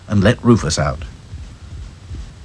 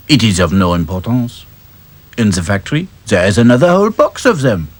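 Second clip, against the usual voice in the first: put-on French-accented doll, breezy. French doll dismissing lost scarf, breezy accent. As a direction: shrug it off with Gallic ease.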